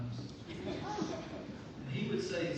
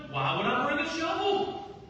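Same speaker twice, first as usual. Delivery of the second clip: higher, louder, exasperated retort; mock indignant reply; 13 semitones higher, 7 dB louder than usual. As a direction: throw the question back, incredulous. Joe retorts, acted.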